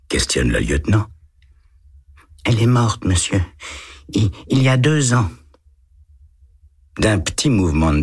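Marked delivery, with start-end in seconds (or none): 2.4-5.3 put-on higher boyish voice, a touch hesitant; little boy voice. A narrator's reading. Boy answering the lieutenant, higher child voice. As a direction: read it as a timid eight-year-old.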